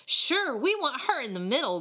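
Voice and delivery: high, gleeful opening; grinning. A narrator's reading. Tin-can boy eager for the kitten prank, grinning. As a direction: say it through a mischievous grin. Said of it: "grinned the boy with the tin can".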